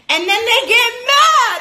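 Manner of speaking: high, loud burst of mock anger; mock outrage. Vlogger mimics partners getting mad, loud and high.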